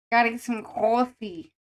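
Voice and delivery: chatting like a New Yorker; a hint of a New York accent. Keeps the New York voice going.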